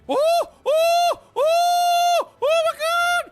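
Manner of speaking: panicked high shrieking; terrified gibbering. Real, not acted. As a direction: scream it in panic. Panic at bugs in base.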